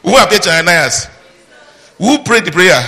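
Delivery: loud, high-pitched rhetorical question; insistent challenge. Real, not acted. Preacher challenging the congregation on who appeared, loud and insistent.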